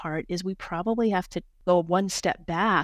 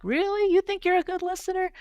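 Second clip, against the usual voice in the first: much higher, disbelieving teasing question; mock incredulous; 12 semitones higher, 3 dB louder than usual. Husband and family teasing her about her listening, mock incredulous. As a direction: imitate skeptical family, pitch shooting up.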